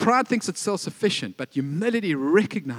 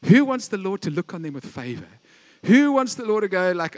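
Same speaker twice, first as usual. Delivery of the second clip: ordinary delivery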